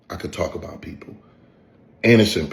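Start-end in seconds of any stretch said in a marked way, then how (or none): 0.1-1.2 unvoiced mocking whisper; low sneering whisper. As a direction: whisper it like a sly confession. Mocking the rival's boast.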